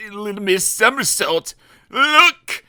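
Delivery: high, loud panicked cry. Acted. Mister Brave on the tightrope crying out in panic, high and loud. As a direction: yelp it in sudden panic. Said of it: "he cried out in panic".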